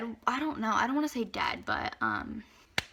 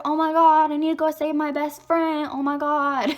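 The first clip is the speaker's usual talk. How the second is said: raised, urgent friend impression; higher-pitched mock panic; imitated panicked friend; 5 semitones higher, 6 dB louder than usual. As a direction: play the friend desperate to rush off to the rescue.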